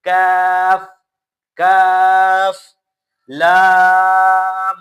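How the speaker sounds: کاف and لام said loud and drawn out; letter drill. Shown as a demonstration. Teacher drilling kaaf and laam aloud. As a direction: hold each letter long and loud. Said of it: "کاف کا مخرج ادا ہوگا".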